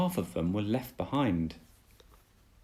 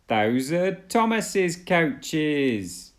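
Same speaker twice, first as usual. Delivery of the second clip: slow, louder alarmed cry; 7 semitones higher, 5 dB louder, much slower than usual. Acted. Oliver seeing Emily with Thomas's coaches, slow alarmed cry. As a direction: call out in shocked surprise. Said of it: "he cried".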